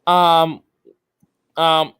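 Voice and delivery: ordinary talk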